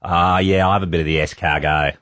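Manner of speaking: broad Australian "escargot" without French sounds; flat Aussie "escargot"; ordering "escargot" in a broad Aussie accent; mock Aussie accent. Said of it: "I don't think you should be going".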